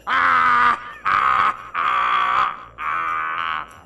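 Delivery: booming villain cackle; evil laugh. Acted. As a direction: throw back your head and cackle. Villain laugh after reading.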